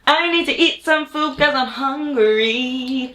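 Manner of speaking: mock whiny voice. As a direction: drag it out like a starving kid. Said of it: "I'm like".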